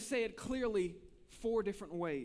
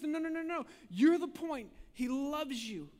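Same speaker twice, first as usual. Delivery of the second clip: higher, louder insistent reassurance; fervent preaching; 6 semitones higher, 4 dB louder than usual. Acted. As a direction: press it home to the listener. Jesus' reply preached loud.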